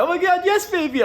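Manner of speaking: very high, squealed mock delight; hammed-up, high-pitched swooning yes; gushing acceptance. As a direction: play an overjoyed bride-to-be in falsetto. Acted acceptance of mock proposal.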